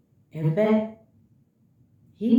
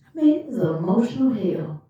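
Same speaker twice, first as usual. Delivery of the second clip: usual speaking voice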